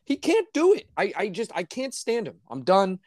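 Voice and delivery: ordinary delivery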